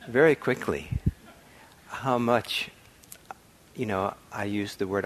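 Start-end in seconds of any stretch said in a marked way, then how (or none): none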